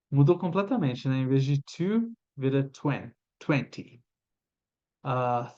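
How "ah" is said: normal talking voice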